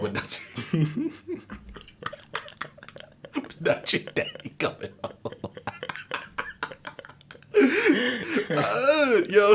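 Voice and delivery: wheezing through laughter; real laughter over the punchline; laughing. Real, not acted. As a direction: get the words out while cracking up. Laughing at own joke.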